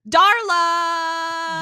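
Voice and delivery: loud, high, drawn-out call; excited cheer. Real, not acted. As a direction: sing out the name in jubilant welcome. Hailing a beloved character's return.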